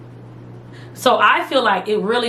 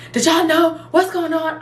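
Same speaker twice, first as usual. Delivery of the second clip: panicked; 7 semitones higher than usual. Retold or imitated. Panicked call to friends.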